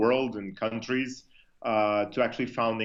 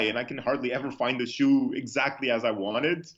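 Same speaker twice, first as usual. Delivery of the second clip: ordinary delivery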